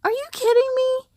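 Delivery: high, incredulous outburst; can't believe he woke up; shocked high protest; disbelief. Acted. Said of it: "he asked out loud".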